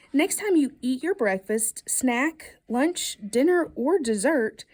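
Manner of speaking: usual speaking voice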